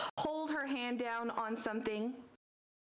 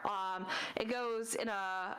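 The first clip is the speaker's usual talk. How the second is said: normal talking voice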